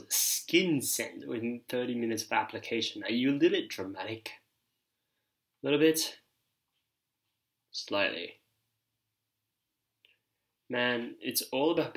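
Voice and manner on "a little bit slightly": teasing. Real, not acted. Teasing the dramatic reviewer.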